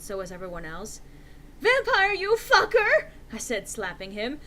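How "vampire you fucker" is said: very high, loud angry cry; furious cry. Acted. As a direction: scream it as you slap him. Slapping him.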